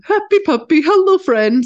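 high, loud, bouncy puppy voice; sing-song like an overjoyed puppy; happy puppy voice. Retold or imitated. Storyteller voicing the happy dog's run, high and bouncy. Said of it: "He does his".

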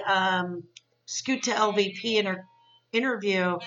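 normal talking voice

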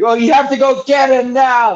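high frantic roommate voice; urgent roommate. Retold or imitated. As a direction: rush him, high and urgent. Roommate voiced in prank call.

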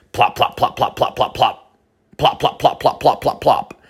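rapid-fire imitated plops; machine-gun plopping. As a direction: rattle off plops in even staccato bursts. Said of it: "machine gun shit".

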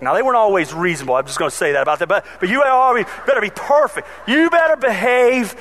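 ordinary delivery

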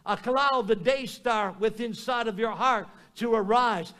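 ordinary talk, nothing marked

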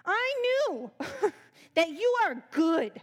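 high, loud, laughing furious whine; mock furious. Jonah ranting at God in mock fury. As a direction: spit out the praise like an accusation. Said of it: "saying that really mad".

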